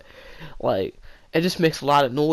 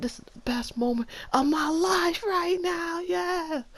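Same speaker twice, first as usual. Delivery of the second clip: ecstatic self-quote; slower than usual. Retold or imitated. Self-quote in ecstasy, high and far.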